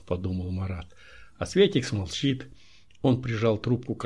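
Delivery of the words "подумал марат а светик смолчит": plain reading voice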